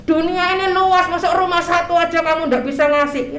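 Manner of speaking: preacher mocking a cheeky prayer, high and pushy; insolent prayer. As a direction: scold God as if He owes you. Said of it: "Itu doa kurang ajar".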